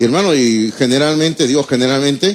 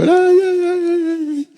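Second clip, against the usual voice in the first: high sobbing ay ay ay; crying wail; 14 semitones higher, slower than usual. Retold or imitated. Father hugging his departing son, high sobbing wail. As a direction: let the ay ay ay break into sobs, high and crying. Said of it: "Lloraba por mi hijo yo".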